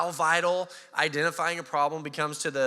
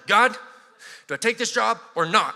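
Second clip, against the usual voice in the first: louder, demanding prayer; urgent prayer; 5 semitones higher, 7 dB louder than usual. Retold or imitated. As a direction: insist on an answer from God.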